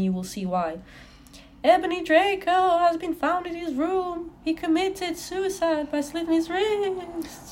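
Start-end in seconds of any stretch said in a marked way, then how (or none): 1.6-7.5 higher, slow put-on voice; dramatic reading. Reading Dumbledore's tragic news in a hammed-up voice. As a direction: announce the news with mock gravity.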